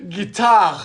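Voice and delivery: a hint of French pronunciation; French accent. Shown as a demonstration. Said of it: "it's guitar with a French accent".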